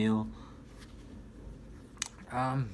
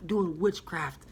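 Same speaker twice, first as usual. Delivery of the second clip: higher, slow amazed voice, as if discovering a real witch; telling a friend the book's author is a witch, amazed and pitched up; excited self-quote; 8 semitones higher, slower than usual. Retold or imitated. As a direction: play your younger self, wide-eyed and pitched up high. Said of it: "and I'm like".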